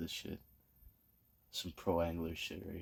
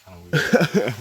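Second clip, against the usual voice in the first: loud mock weirded-out; 7 semitones higher, 16 dB louder, slower than usual. Real, not acted. Teasing about picture taking.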